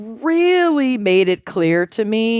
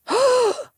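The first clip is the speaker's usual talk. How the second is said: excited gasp. Real, not acted. Sees armadillo hoodie.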